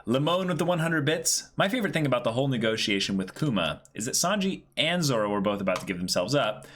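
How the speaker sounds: bright, high chat-reading voice, pitched well up; upbeat message read. Acted. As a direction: read the donation out like a cheerful announcement.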